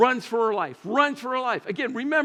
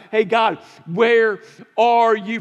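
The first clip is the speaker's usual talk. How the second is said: loud, slow, anguished cry; crying out to God; 3 semitones higher, 8 dB louder, slower than usual. Retold or imitated. Ruined worker crying out to God, loud anguished plea. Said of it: "you screamed out to God".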